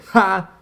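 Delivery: loud, high gloating "Ha!"; triumphant sneering laugh; mocking laugh. A narrator's reading. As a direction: laugh in his face.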